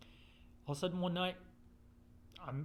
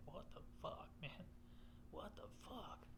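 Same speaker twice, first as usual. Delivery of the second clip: hushed whispered disbelief, much softer; 4 semitones higher, 17 dB quieter than usual. Retold or imitated. Alone at the ballgame staring at a feather, hushed whisper. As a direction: breathe it out in quiet awe.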